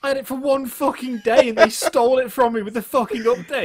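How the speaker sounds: loud, high-pitched indignant complaint; aggrieved shouting over an injustice; outraged rant. Real, not acted. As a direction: rant it at full voice, hitting the swear words. Ranting about the stolen surprise.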